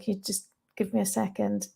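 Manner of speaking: retold request said very softly, almost under the breath; hushed aside. On stage, quietly asking the audience for a moment, hushed. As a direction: murmur it as if stepping back from the mic.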